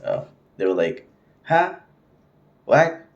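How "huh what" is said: high-pitched rude "huh?", sharply rising; rude retort. Retold or imitated. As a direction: imitate a rude saleswoman's questioning retort. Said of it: "so rude".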